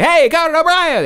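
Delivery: high, loud booming announcement; announcer voice. Retold or imitated. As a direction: call it out like a TV announcer. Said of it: "He would say that".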